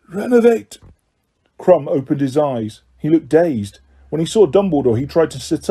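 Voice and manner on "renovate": usual speaking voice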